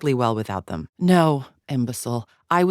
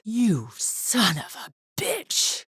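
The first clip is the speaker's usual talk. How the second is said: slow, high, seething curse; high-pitched indignation, drawn out; outraged cursing; 6 semitones higher, 4 dB quieter, much slower than usual. Acted. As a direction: draw out the insult in disgust. Villain cursing a rival.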